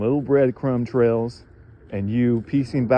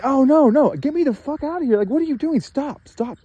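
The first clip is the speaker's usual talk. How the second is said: much higher, pleading yells to stop; mock-panicked cries for them to stop, pitched way up; imitating a beaten man's pleading; 11 semitones higher, 4 dB louder than usual. A beaten man begs his tormentors to stop, in high frantic pleading. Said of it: "And he's saying".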